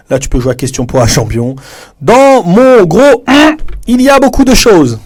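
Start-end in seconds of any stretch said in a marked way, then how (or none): 2.0-5.0 much higher, louder, very drawn out; game show host. Shown as a demonstration. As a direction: tease the answer like a quiz host. Quiz game host demo. (animateur de jeu télévisé, voix très haute, forte et traînante)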